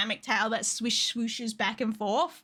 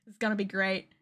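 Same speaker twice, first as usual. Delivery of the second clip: normal talking voice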